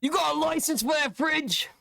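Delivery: cheeky London geezer accent on "fridge"; British copper accent asking "You got a license for that fridge?". Shown as a demonstration. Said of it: "Am I supposed to say that with my accent?".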